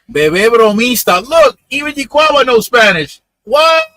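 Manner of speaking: loud, high excited outburst. Real, not acted. Streamer reacting to a viewer's Spanish comment, loud and excited. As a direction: burst out in delighted surprise.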